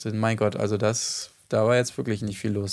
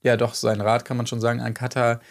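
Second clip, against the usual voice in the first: ordinary talk, nothing marked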